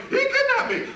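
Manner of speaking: high, loud hammed-up cry of a kidnapping victim; mock wife's scream. Lawyer mimicking the complainant's wife accusing the client, a hammed-up high shriek. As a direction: shriek it like the outraged wife, half laughing. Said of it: "somebody going".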